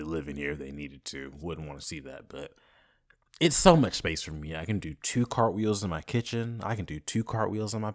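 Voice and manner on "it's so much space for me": normal talking voice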